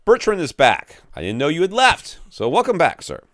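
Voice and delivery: high, loud mock-excited greeting; hammed-up welcome. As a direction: welcome a returning listener with big show. Hammed-up welcome to a returning listener.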